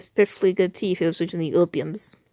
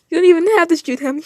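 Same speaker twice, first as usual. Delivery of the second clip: higher and louder American-style taunt; mocking, raised-voice read; taunting character voice; 11 semitones higher, 8 dB louder, faster than usual. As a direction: jeer at him, pitched up and loud. Voicing China's taunt.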